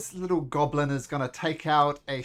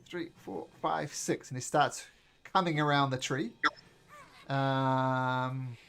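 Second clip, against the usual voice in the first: ordinary talk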